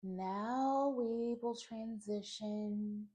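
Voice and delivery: slow, drawn-out meditation voice with long pauses; guided meditation. Acted. Teacher leading listeners through a clearing breath, slow guided meditation. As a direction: guide each breath slowly, stretching the words.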